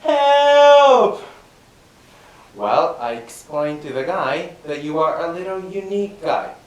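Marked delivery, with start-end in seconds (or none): 0.0-1.2 high, loud cry for help from the river; shout for help. Acted. Student thrown in the river, screaming for help. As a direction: yell it desperately from the water.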